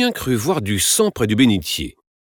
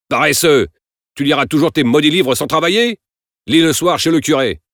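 raised, higher-pitched angry rebuke; angry scolding; 7 semitones higher, 5 dB louder than usual. A narrator's reading. Father scolds his son for reading instead of working, angrily. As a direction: berate him loudly.

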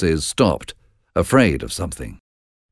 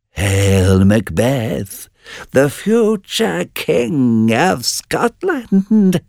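slow prophecy rising to a high wail; third witch crying out; 8 semitones higher, much slower than usual. A narrator's reading. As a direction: draw the prophecy out, climbing high. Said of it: "cried the third".

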